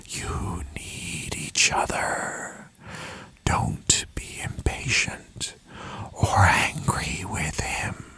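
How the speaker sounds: very slow, fading whisper; ghostly echoing father. A narrator's reading. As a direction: breathe each phrase out, drifting away into silence. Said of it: "echoed from every surface".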